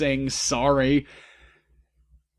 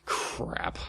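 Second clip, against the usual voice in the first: ordinary delivery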